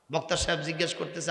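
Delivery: normal talking voice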